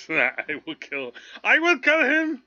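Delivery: trying the high dramatic threat; imitating Dune line. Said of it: "That's, that's Dune".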